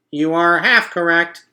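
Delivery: a cheerful machine making a speech; robot voice. A narrator's reading. Roz announcing the party truce to the animals, perky robot.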